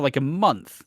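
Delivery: normal talking voice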